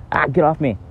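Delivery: high, sudden outburst; real startled yelp. Real, not acted. Vlogger finding an ant on them, startled yelp.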